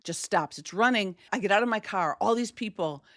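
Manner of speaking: normal talking voice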